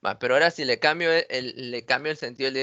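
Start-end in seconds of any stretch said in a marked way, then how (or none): none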